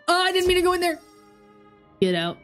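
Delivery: ordinary delivery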